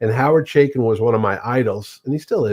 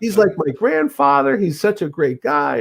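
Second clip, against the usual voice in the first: mock gushing; 8 semitones higher than usual. Wife praising the man she once swore at, gushing. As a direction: gush high, then draw out the compliment.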